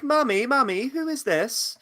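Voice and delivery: put-on high-pitched posh British child; posh British boy. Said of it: "he was very posh British".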